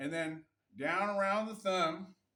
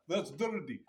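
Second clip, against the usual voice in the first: mock Russian accent, lower; thick Russian accent; 4 semitones lower than usual. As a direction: put on an overdone Russian accent, pitched down. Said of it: "his accent wasn't that thick".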